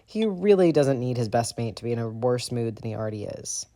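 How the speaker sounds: ordinary delivery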